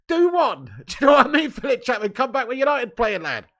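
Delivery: high, loud "get lost" jeer; mock dismissal. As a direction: shout him off with a laugh. Telling off a rude commenter.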